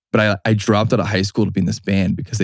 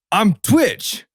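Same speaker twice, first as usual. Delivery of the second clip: much higher, put-on proud kid voice; kid's nickname intro; 12 semitones higher than usual. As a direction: announce it like a cocky teen. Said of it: "the kid's like".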